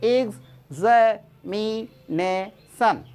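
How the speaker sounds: Examination stretched very slowly, each syllable stressed; exaggerated teacher demo; stressed slow pronunciation. Shown as a demonstration. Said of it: "तो यहाँ पर stress भी जाना है".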